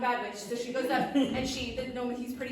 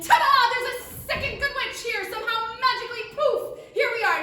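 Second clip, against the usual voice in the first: high, loud ta-da flourish; excited reveal; 13 semitones higher, 11 dB louder than usual. Acted. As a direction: announce a magical appearance with fanfare. Magical reveal of second witch.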